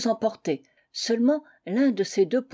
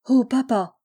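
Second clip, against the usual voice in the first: raised, louder exclamation full of feeling; emotional cry; 3 semitones higher, 5 dB louder than usual. A narrator's reading. Young woman cries to her father about the injured man, emotional cry. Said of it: "avec un accent plein d'émotion".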